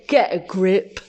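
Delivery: a hint of a gruff father; stern dad. A narrator's reading. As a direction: tell them off as an unimpressed dad. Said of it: "and Dad said".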